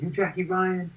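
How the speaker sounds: light mock of a thrilled stranger asking; starstruck question. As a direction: fan walking up to Jackie, high excited question. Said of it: "So he was like".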